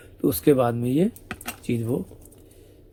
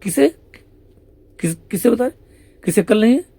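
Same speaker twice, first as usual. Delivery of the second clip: much higher, wide-eyed innocent asking; feigned innocence; 8 semitones higher, faster than usual. Acted. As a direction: play dumb, rising questions. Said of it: "आपने देखा होगा भी होता है". (बहुत ऊँची आवाज़ में भोली बनकर पूछना)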